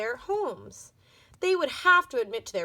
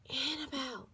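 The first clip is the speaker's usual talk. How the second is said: urgent whisper; 4 semitones higher, 7 dB quieter than usual. Acted. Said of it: "Tiffany whispered urgently".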